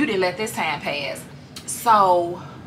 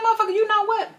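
much higher, fed-up outburst; fed-up Ani; 12 semitones higher than usual. Retold or imitated. Ani breaks up with fiance, high fed-up cry. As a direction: finally snap at him. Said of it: "And she like".

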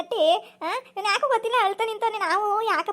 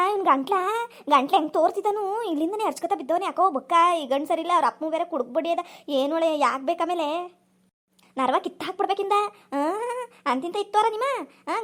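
ordinary talk

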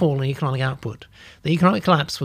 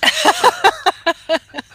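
camp cackle; 5 dB louder than usual. Shown as a demonstration. After camp exclamation.

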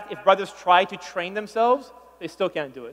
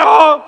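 loud, pitched-up ah; a hammed-up high ah; mock high "ah"; 5 semitones higher, 13 dB louder than usual. Shown as a demonstration. Comedian mimics a brother going too high, loud. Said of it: "One guy went too high".

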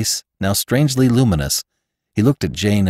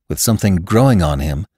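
usual speaking voice

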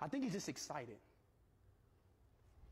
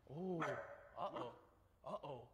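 ordinary delivery

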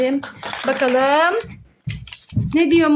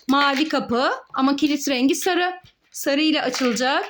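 normal talking voice

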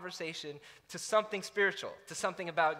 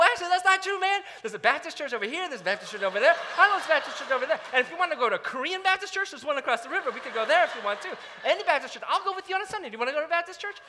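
much higher and louder, fast and pushy; rapid-fire eagerness; 7 semitones higher, 5 dB louder, much faster than usual. Retold or imitated. Preacher reenacting overeager pitch to his fraternity brother, fast and excited. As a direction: pile on every option without a breath.

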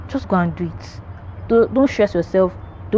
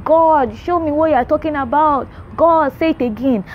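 imitated pleading prayer, pitched higher; begging; 5 semitones higher than usual. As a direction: plead with God, acting out the begging.

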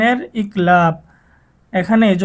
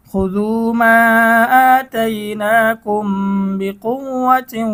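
slow chanted tilawat; Quran recitation; 3 semitones higher, much slower than usual. Shown as a demonstration. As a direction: recite with long madd and ghunna. Recites the verse slowly. (ধীর তিলাওয়াত)

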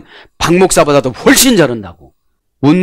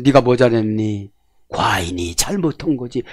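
king forgiving; 4 semitones lower, 7 dB quieter than usual. Retold or imitated. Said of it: "사극 봐요".

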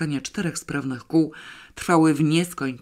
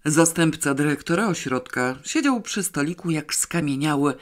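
plain reading voice

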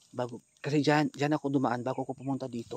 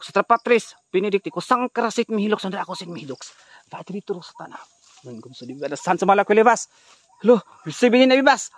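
louder mock incantation; 5 semitones higher, 10 dB louder than usual. Vlogger chanting the exorcism again, louder. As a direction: chant the pseudo-Latin spell, louder and higher.

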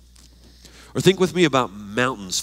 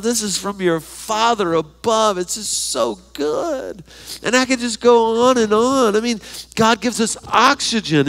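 much higher and louder, bubbling over; exuberant joy; 10 semitones higher, 4 dB louder than usual. Real, not acted. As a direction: pour out the list with delight. Exuberant list of God's gifts.

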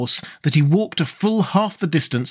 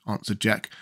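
ordinary delivery, nothing marked

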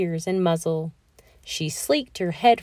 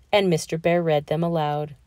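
ordinary delivery, nothing marked

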